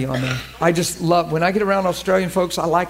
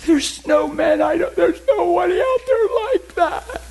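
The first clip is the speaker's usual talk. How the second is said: high tearful mock sob in a girl's voice; imitating desperate crying; 11 semitones higher than usual. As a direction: voice the crying girl, pitched up. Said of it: "she cried desperately and said".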